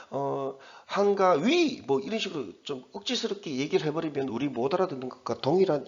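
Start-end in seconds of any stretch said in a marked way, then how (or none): 0.8-1.8 put-on "한가 위" in an awkward foreign accent; clumsy foreigner's Korean. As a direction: mimic a foreigner forcing out Korean, misplacing the stress. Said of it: "외국인이 한국말 어설프게 하면서".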